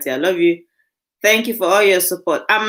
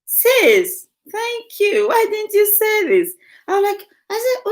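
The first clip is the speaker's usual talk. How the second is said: much higher, breathless happy thanks; excited; 14 semitones higher than usual. Real, not acted. Host thanking her sister in the chat, excited and high. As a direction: gush with delight and mock reproach.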